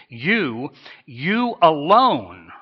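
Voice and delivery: YOU ALONE drawn out slow and loud; emphatic. Acted. Jesus singling out his disciples, emphatic. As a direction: lean hard on "you alone", stretching it. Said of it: "saying it once again in the emphatic".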